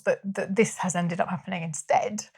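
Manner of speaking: ordinary talk, nothing marked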